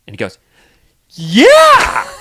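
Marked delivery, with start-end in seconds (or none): 1.1-2.1 put-on toddler voice. Toddler answering yeah.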